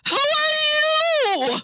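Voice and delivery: very high, stretched-out wail; hammed-up hysterical cry; panicked shriek. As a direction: shriek it back, drawing out the last word. Acted panic, echoing the librarian.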